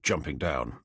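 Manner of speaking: usual speaking voice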